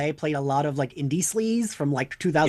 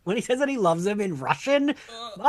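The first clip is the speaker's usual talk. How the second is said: ordinary delivery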